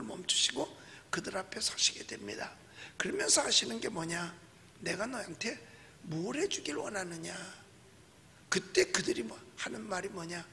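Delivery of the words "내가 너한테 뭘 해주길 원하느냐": gentle Jesus voice. Retold or imitated. Jesus voiced asking blind men.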